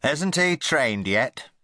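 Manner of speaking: put-on slow, cross old aunt; a touch of irritation, drawn out; aunt crossly asking about the puppy. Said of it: "asked Rupert crossly".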